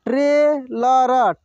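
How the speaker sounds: Trailer drawn out slowly, syllable by syllable; slow sounding out. Shown as a demonstration. Teacher slowly sounds out trailer for students. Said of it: "एक एक मात्रा को अलग अलग करके".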